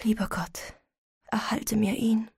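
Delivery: hushed, trembling prayer whisper. A narrator's reading. Weeping mother prays to God for her son, whispering. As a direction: pray under your breath, fearful. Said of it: "sprach leise mit bebender Stimme".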